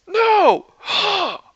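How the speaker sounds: loud, very high shouted reactions; protest, then triumph. A narrator's reading. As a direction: yell an outraged refusal, then gloat loudly. Tony and Thor yelling back.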